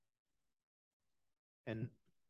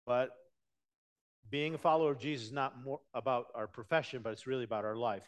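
ordinary talk

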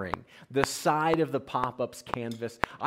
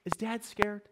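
calm hushed mom; 8 semitones higher, 6 dB quieter than usual. Retold or imitated. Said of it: "Mom looks at him and says".

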